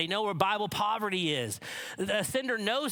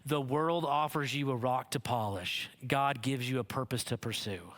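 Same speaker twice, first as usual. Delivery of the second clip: solemn fatherly lesson; 6 semitones lower than usual. Retold or imitated.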